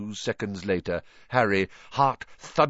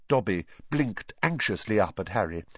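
ordinary delivery

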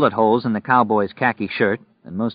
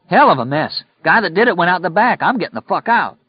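urgent, higher-pitched yell; agitated calling out; 9 semitones higher, 4 dB louder than usual. Acted. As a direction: blurt it out while fleeing from the scene. Lloyd cried.

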